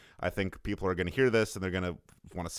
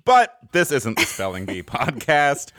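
laughing; 3 semitones higher, 7 dB louder, slower than usual. Real, not acted. Joking game-show setup.

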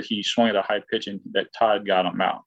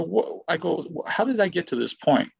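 high-pitched disbelief; retold bewilderment; 7 semitones higher than usual. Struggling player asking himself how he got here, high and bewildered. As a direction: pitch it high, baffled at yourself.

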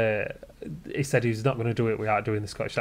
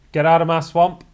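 loud, higher mock ogre bellow; Shrek impression; 6 semitones higher, 11 dB louder than usual. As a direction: belt out the ogre's catchphrase as a gag. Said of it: "is, say it, go for it".

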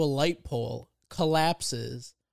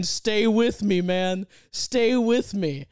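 raised, louder mock-dramatic plea; urgent rescuer; 4 semitones higher, 4 dB louder, much slower than usual. Kid pounding on a collapsed man's chest, urgent mock plea. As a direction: imitate a would-be lifesaver, drawing out the last plea. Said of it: "pounding on this dude's chest".

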